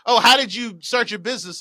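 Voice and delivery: bright interviewer voice. Retold or imitated. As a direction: keep the chat alive with cheer. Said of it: "And I'm like".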